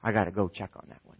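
quietly decide it is serious; hushed note to self; concerned parent. Acted. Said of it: "and you go".

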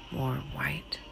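usual speaking voice

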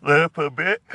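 talking through a yawn. Real, not acted. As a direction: stretch the words out mid-yawn. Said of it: "Sorry, I'm yawning".

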